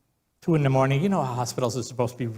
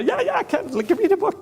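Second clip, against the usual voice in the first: eager excitement. Retold or imitated. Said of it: "it's so exciting".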